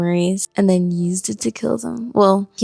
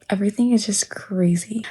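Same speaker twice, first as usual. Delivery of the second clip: usual speaking voice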